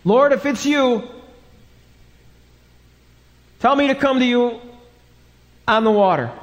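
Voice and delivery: high, projected call across the water. Acted. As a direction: call to someone out on the waves, in earnest. Peter calling to Jesus across water.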